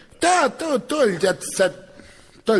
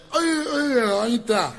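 put-on drunk apology; 4 semitones higher, slower than usual.

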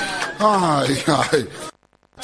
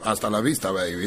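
Terminator; 13 semitones lower, 5 dB quieter than usual. Retold or imitated. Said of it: "parecía Terminator".

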